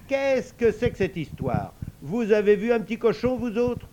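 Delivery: loud, high-pitched questioning; cross father. Acted. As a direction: demand answers as an exasperated father. Father questions his children crossly.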